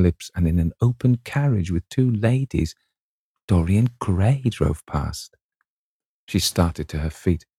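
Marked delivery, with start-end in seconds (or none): none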